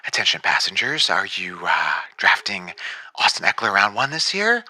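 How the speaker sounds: low, deep pilot on the intercom; airline pilot voice. Acted. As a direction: announce it like the captain. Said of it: "I love the airline pilot sound effect".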